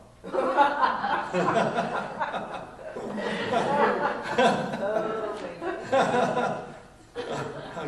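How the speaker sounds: long high laughing fit; hearty laugh. Real, not acted. Preacher laughing at his own joke, big laugh. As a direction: laugh out loud at the punchline.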